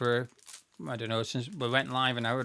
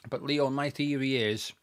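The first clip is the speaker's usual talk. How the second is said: usual speaking voice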